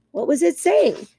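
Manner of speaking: a small creature leaning in to ask; groundhog asking. Acted. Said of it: "said Dig Down, the groundhog".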